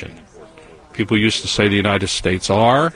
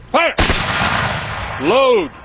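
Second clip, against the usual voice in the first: firing squad command; 13 semitones higher than usual. Real, not acted. Honor guard firing commands.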